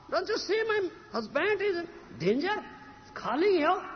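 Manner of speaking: high-pitched scolding, storyteller's imitation; retold harsh rebuke. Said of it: "Very harsh words".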